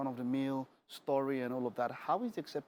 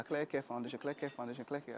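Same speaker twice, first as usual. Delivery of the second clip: rattled off fast in repeats, getting softer; rapid tongue twister; 5 dB quieter than usual. Shown as a demonstration. Host trying the foundation's name as a tongue twister, said fast. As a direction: race through it like a tongue twister. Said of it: "you might want to say that fast".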